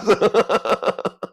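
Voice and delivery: high, rolling laugh; real laughter. Real, not acted. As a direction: laugh hard after the punchline. Laughs at own story.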